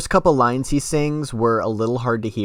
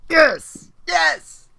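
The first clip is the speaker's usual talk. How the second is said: high, louder triumphant yes; excited cheer; 6 dB louder than usual. Real, not acted. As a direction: cheer like you finally won the argument. Co-host finally likes the band.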